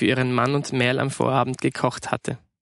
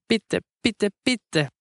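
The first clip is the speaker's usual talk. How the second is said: put-on high, wheedling child's begging; childlike singled-out pleas; pleading; 7 semitones higher, much slower than usual. A narrator's reading. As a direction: plead with Mum, word by word. Child begs mother for lasagne.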